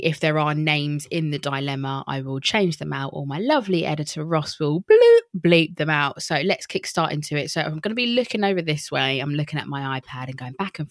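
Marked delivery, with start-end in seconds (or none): 4.8-5.2 high trilled bloop sound effect; bloop noise. Shown as a demonstration. Host showing how the editor censors names, playful bloop. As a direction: imitate a censor bleep.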